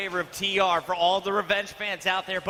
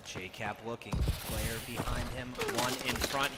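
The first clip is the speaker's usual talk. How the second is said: hushed tense call; 10 semitones lower, 10 dB quieter than usual. Real, not acted.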